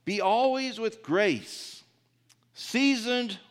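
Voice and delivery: slow, high, solemn Bible reading; scripture reading. Acted. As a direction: read the verse out slowly to the flock. Reads scripture aloud.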